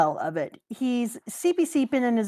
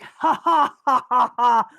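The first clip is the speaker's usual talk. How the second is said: villain laugh; 6 dB louder, slower than usual. Retold or imitated. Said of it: "he's like".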